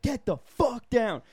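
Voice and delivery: high, loud, drawn-out order; imitated furious yell. School staffer ordering the climber down, mock furious yell. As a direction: bellow it slowly as an outraged adult. Second adult yelling at climber.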